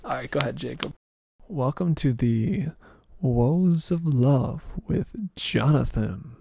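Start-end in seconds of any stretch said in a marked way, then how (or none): 1.5-6.3 slow, grand show-intro announcer voice. Acted. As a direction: announce the show title with flourish.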